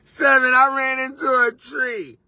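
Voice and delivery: put-on high, crying-child complaint; whiny complaint. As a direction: whine like a hurt kid. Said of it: "Then you wanna be".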